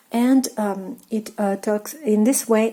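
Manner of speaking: normal talking voice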